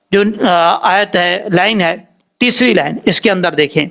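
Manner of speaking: usual speaking voice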